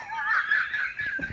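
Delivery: sudden high squealing laugh; burst of laughter. Real, not acted. Laughing at his own retold roof threat, high squeal. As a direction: crack up at your own story.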